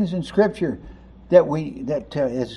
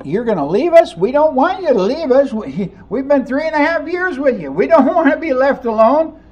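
higher, louder dismayed pleading; mildly acted upset disciples; 8 semitones higher, 3 dB louder than usual. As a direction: beg him not to go. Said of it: "they're upset".

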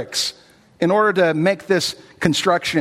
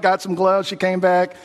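ordinary talk, nothing marked

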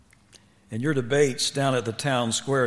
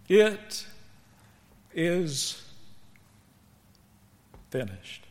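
solemn pronouncement; 5 semitones higher, much slower than usual. Acted. Preacher quoting dying words, solemnly.

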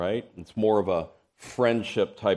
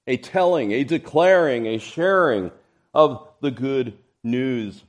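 rising, louder preaching list; building emphasis; 3 semitones higher, 6 dB louder than usual. Real, not acted. Preacher urging the church to proclaim, building louder. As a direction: build each word louder.

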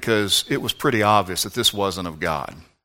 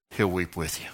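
much softer, low and tender; tender hush; 4 semitones lower, 8 dB quieter than usual. Acted. Preacher assuring the grieving listener, soft and tender. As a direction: comfort them gently.

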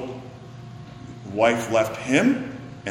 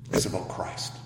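whisper; 5 semitones lower, 11 dB quieter than usual. Real, not acted. Quiet closing of sermon.

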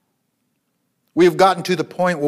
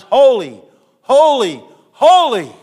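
higher and louder on each holy; building shout of holy; 8 semitones higher, 8 dB louder than usual. Acted. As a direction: ring out the holies to a climax. Preacher's climactic refrain.

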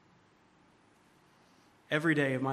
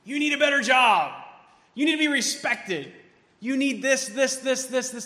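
voicing the world's pushy shout, higher and louder; a nagging voice that commands you; worldly demand, yelled; 8 semitones higher, 6 dB louder than usual. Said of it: "The world may be screaming at you".

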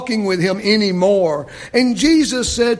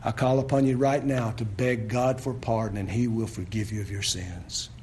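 low, hushed pleading invitation; soft altar call; 9 semitones lower, 9 dB quieter than usual. Acted. Pastor calling sinners to repent, softly and low. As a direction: draw them gently to repent.

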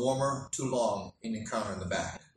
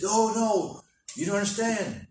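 excited insisting; 6 semitones higher than usual. Retold or imitated. Man at the gas station correcting the pastor, excitedly. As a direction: press the point, voice up.

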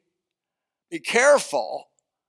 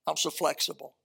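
sarcastic; 2 semitones lower, 8 dB quieter than usual. Retold or imitated. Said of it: "I said".

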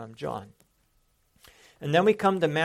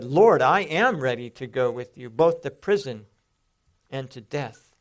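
loud, firm declaration, LORD and AM driven hard; emphatic declaration; 7 dB louder than usual. Retold or imitated. Peter declares he is ready for prison and death, emphatic. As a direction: declare it with force, easing off at the end. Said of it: "a much more emphatic declaration".

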